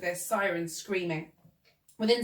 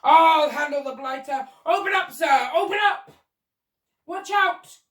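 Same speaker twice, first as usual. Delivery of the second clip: loud, higher and higher shouts; bellowing shout; 7 semitones higher, 10 dB louder than usual. Acted. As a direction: roar orders at the bird. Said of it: "bellowed the Duke".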